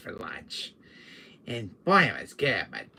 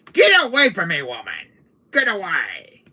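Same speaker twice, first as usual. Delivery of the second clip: loud shout, falling at the end; hammed-up exasperated yell; yelling her away; 4 semitones higher, 10 dB louder than usual. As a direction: shoo her off forcefully. Fending off the woman.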